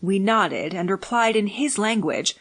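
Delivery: usual speaking voice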